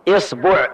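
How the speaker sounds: إصبع with i then u on ba, isbuʕ; إصبع, i start and rounded middle, isbuʕ; isbuʕ with kasra. Shown as a demonstration. Teacher demonstrates the next variant, isbuʕ. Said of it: "نأخذ كسر الهمزة".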